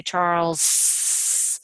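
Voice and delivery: "Charles" with the final s hissed and held long; drawn out Charles. Shown as a demonstration. Teacher jokingly holding the s in "Charles". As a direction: stretch the s of "Charles" out a long time. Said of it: "that's kinda weird".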